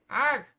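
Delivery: sharp loud cry of pain; pained shout. Acted. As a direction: yell as if hit hard. Character struck in a fight.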